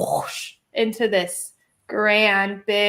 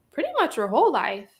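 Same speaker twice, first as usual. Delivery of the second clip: usual speaking voice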